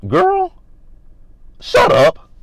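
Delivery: high, loud, sassy dismissal; hammed-up exasperated retort; mock telling-off. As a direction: pitch it way up and shut her down. Acted retort telling castmate off.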